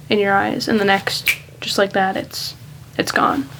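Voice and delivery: real choked-up moment, halting with long pauses; quietly overcome, struggling to go on; getting emotional. Real, not acted. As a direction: let the voice falter and fade as emotion catches you. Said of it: "I'm getting a little emotional about that".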